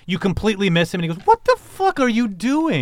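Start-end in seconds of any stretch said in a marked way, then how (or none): 1.3-2.8 startled yell. Acted. GM voices NPC yelling at player.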